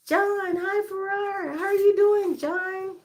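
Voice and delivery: high excited sing-song hello; sing-song greeting. Real, not acted. Excited live-stream greeting to viewer.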